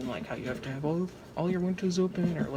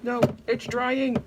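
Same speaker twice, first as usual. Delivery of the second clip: higher, louder, slowed protest; frustrated shout; 8 semitones higher, 5 dB louder than usual. Real, not acted. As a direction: yell at the drying soap. Soap drying too fast.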